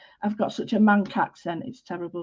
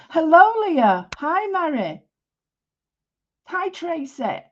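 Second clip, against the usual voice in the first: high, swooping sing-song hello; sing-song greeting; 7 semitones higher, 4 dB louder than usual. Real, not acted. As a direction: greet each one with a high voice that falls away. Greeting viewers as they join.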